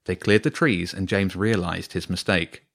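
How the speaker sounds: usual speaking voice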